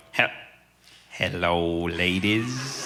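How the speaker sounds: lightly voiced old charmer greeting the girls, with a laugh; a hint of a gallant old man saying hello; charming old man greeting. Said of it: "he would be like".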